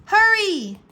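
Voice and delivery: high, loud urgent yell. A narrator's reading. Drake urging Vulcan on, a high loud yell. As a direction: shout it to get the dragon moving. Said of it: "Drake yelled".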